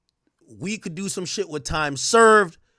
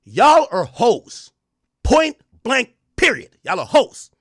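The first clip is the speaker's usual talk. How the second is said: much louder, slow, each word punched; emphatic shout; 4 semitones higher, 7 dB louder, slower than usual. Real, not acted. Streamer hammering the verdict home to the women, loud.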